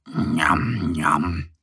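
low smacky njam njam; bear smacking lips. Acted. Little bear Mo munching in his sleep, smacking. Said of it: "schmatzt der kleine Bär Mo".

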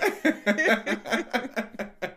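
high burst of laughter. Real, not acted. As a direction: laugh at your own joke. Laughs after warmth joke.

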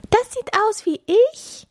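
very high squeaky squirrel. A narrator's reading. Squirrel recognises itself in snow, very high squeak. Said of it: "Das eine Eichhörnchen".

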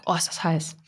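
just sipped hot coffee; while sipping a drink. Real, not acted. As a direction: speak right after a hot sip. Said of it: "ich trinke mal kurz von meinem Käffchen". (nach einem Schluck heißem Kaffee)

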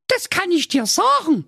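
very high, squeaky princess in Saxon. A narrator's reading. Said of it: "sagte die Prinzessin Milram".

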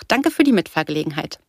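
plain reading voice

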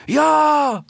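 very high cry of animals; crowd shout. A narrator's reading. Animals answer their leader, high shouted reply. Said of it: "riefen die Tiere".

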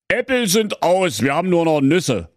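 high wolf, brushing off; lightly voiced wolf. A narrator's reading.

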